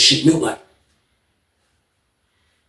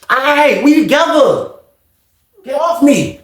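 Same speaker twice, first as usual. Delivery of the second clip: urgent protest; 10 semitones higher, slower than usual. Retold or imitated. Retold man grabbed by the neck.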